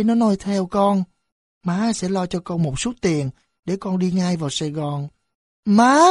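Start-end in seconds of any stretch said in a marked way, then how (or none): 5.6-6.0 loud sobbing cry of Má; boy breaks down and calls his mother. A narrator's reading. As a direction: burst into tears calling mother. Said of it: "Sơn bật khóc nức nở".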